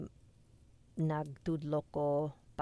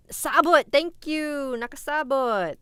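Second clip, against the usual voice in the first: loud, high burst of recognition; excited relief; 10 semitones higher, 10 dB louder, slower than usual. Real, not acted. Learner thanking chat for the forgotten word, excited cry. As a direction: cry out as the lost word comes back.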